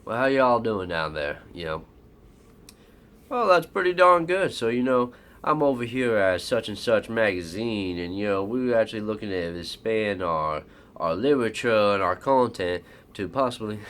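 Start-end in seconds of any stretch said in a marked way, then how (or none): 0.0-1.4 louder Southern country greeting; country accent. Acted. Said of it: "you know, my country accent". 3.3-13.8 put-on country accent. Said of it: "you know, my country accent".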